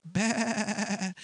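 quavering little bleat; sheep bleat. Acted. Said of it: "Sheep are".

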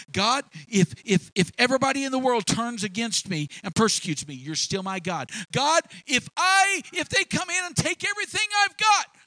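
rising to a loud, high preaching cry; building sermon cry. Real, not acted. Preacher to his congregation, building to a high shout. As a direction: preach it harder line by line, up to a shout.

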